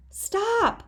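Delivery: high, sharp shout to stop. A narrator's reading. Big brother yelling at his sister to stop: high shout. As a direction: call out to halt her. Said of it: "I shouted".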